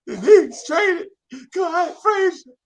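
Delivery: high, slow, loud crying words; choked sob. Retold or imitated. Heartbroken kid tells best friend the news, sobbing. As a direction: choke it out through tears. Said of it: "I choke it out".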